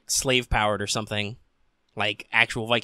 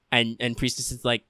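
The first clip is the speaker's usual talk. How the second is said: usual speaking voice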